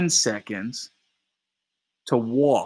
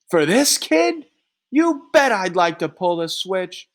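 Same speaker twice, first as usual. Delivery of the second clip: furious yell; 9 semitones higher, 4 dB louder than usual. Acted. Three rages about the defendant.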